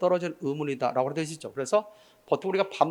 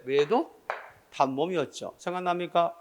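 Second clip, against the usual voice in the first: ordinary talk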